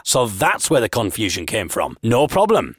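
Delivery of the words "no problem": plain reading voice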